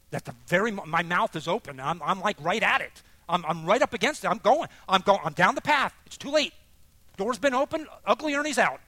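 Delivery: loud, high, building rant; worked-up power speech. Retold or imitated. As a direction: build to an unstoppable shouted tirade. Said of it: "the power speech from Ernie".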